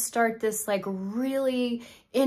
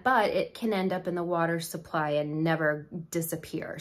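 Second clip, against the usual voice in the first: ordinary talk, nothing marked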